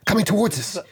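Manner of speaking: high, urgent warning; urgent alarm. Acted. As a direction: point and warn urgently. Warning urgently about shadow.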